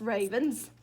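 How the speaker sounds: ordinary delivery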